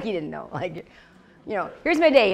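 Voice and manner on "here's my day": brisk, loud handing over of the day; mock eager offering. Young believer telling God, mock eager and loud. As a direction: hand God your day on your terms. Mock repeat of old prayer.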